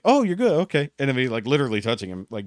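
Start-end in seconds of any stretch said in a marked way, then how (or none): 0.1-0.9 squeaky high fake reassurance; mock relieved surprise. Caught talking about the boss, gushing with fake relief. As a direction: gush a fake all-clear at him.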